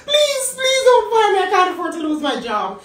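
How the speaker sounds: begging the manager to keep her job, crying; desperate begging. Retold or imitated. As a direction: beg through tears not to be fired. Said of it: "I began to beg".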